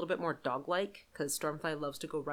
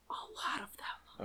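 whispered; 3 semitones higher, 11 dB quieter than usual. Real, not acted. Stressing how many teeth.

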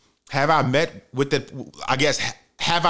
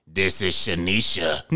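much lower, slow mock deep voice; deep-voiced woman; 6 semitones lower than usual. Date calmly gives her name in a mock deep voice. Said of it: "But her voice was deep as fuck".

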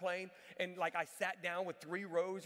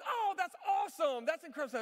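high, bright gushing lady, higher and louder; excited lady; 8 semitones higher, 6 dB louder than usual. Retold or imitated. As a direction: gush like a delighted stranger. Excited woman voiced in story.

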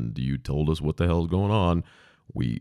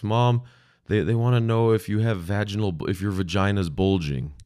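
put-on little girl voice; 5 semitones higher, 3 dB louder than usual. Girl relays question to mom.

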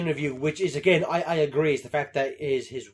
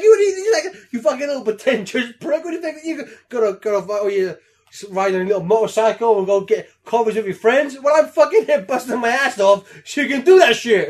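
hammed-up higher, louder dad rant; angry father; 6 semitones higher, 6 dB louder than usual. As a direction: tear into the spoiled kid. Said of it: "And he's like".

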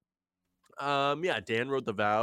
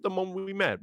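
high, fast falsetto gush, hammed up; mock a lovestruck yes, way up high and rushed; gushing eager reply; faster than usual. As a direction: overeager swooning voice, much higher and quicker. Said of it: "I'd be like".

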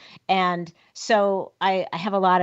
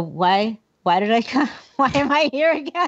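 puzzled laughing self-talk; 7 semitones higher than usual. Retold or imitated. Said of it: "I go".

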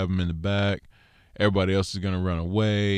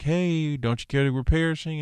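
high, fast, alarmed cry in the storm; panicked disciples; 7 semitones higher than usual. Retold or imitated. Disciples waking the sleeping Yeshua in the storm, panicky and fast. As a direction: cry out like men sure they're drowning. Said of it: "and they're like".

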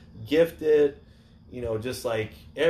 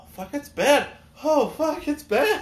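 high panicked alarm; 4 dB louder than usual. Retold or imitated. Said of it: "I'm like".

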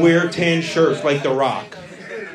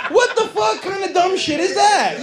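high-pitched, exasperated outburst; mock outrage; 12 semitones higher than usual. Arguing with girlfriend about driving, mock outraged outburst. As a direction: blurt it high, in hammed-up disbelief. Acted quarrel over FaceTime.

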